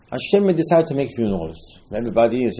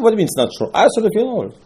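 insistent retort; 9 semitones higher, faster than usual. Retold or imitated. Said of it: "I said".

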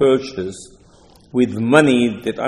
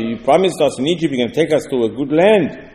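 usual speaking voice

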